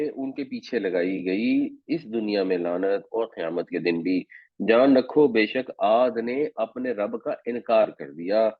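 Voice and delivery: ordinary talk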